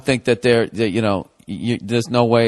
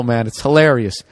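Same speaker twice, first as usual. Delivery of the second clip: ordinary talk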